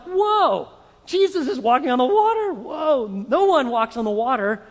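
high, loud astonished cry, easing toward the end; shocked disciples. Acted. Disciples spotting Jesus on the lake, astonished. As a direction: blurt it out in amazed disbelief.

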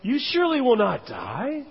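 high, stretched "surely", rising at "die"; emphatic. Retold or imitated. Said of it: "he's emphatic about".

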